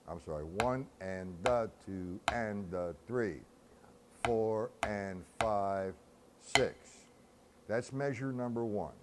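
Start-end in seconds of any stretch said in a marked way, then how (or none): 0.4-6.7 slow, evenly measured count; drawn-out rhythmic counting; breakdown count. Shown as a demonstration. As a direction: count out each beat slowly, in strict time. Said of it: "that's the breakdown".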